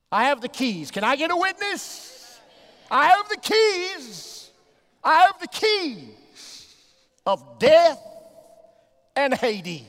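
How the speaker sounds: high, loud, stretched-out sermon whoop; preaching at full force. Acted. Preacher proclaiming Jesus has the keys of death, full-force whoop. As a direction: ring out the words to the rafters, slow.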